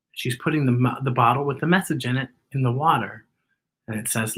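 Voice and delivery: usual speaking voice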